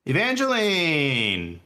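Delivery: high sing-song call to a player. Real, not acted. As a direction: call her name across the table. Calling a player by name.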